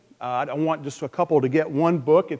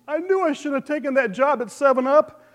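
lamenting father; 10 semitones higher, 3 dB louder than usual. Acted. Joke punchline, crying father.

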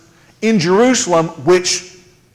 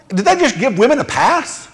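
ordinary talk

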